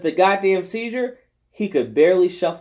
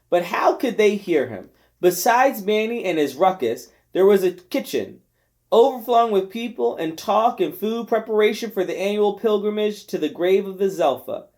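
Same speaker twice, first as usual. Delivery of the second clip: ordinary delivery, nothing marked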